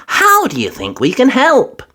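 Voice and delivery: put-on high eager little girl; Peppa voice. A narrator's reading. Peppa suggesting the ladder rescue, eager. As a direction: share the idea brightly.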